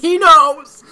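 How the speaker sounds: loud, high delighted yell; excited exclamation. Real, not acted. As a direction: shout it in gleeful disbelief. Laughing reaction to the joke.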